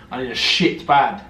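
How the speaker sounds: ordinary talk, nothing marked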